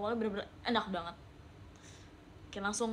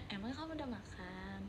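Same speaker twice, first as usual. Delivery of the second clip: soft tender question; 2 semitones lower, 12 dB quieter than usual. Shown as a demonstration. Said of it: "Pasti pengennya".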